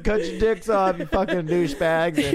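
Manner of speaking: high, loud mock drunk yelling; drunken yelling threat. Drunk woman yelling threats at guys while dragged outside. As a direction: yell it like a furious drunk being dragged out.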